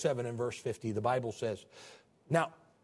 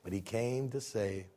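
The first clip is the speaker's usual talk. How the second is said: quiet echo; 7 semitones lower, 6 dB quieter than usual. Acted. Preacher repeats the point to the church, soft and low. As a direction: let it land gently.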